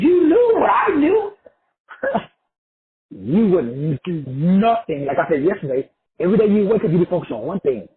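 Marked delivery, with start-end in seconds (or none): 0.0-3.1 high and loud, breaking into a laugh; laughing as he says it; laughing high outburst. Real, not acted. 3.1-5.1 slow, laughing through the words; chuckling slowly. Real, not acted. Laughing, drawn out.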